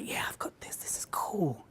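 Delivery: soft whisper to yourself; a speaker psyching himself up on stage, whispered; whispered self-talk. Retold or imitated. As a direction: whisper it, smug and sure. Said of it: "I was like".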